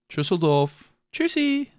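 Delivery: high little cutesy voice; sing-song cutesy goodbye. Shown as a demonstration. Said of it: "Like a little cutesy voice".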